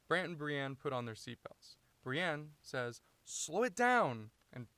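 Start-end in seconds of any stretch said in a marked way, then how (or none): 3.2-4.3 high, loud, drawn-out yell; urgent shout. Acted. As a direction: shout it in alarm, stretching the words. Acted panicked car quarrel.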